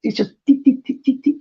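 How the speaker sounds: high mock thumping beat; imitating bass beat. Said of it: "It's like".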